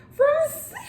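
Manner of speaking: high, loud shout breaking into laughter; excited reveal. Real, not acted. Vlogger finding out which friend sent the gift, excited. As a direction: burst out with the name, thrilled.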